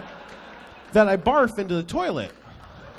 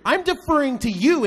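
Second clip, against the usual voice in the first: mock indignant; 7 semitones higher than usual. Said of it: "I'm like".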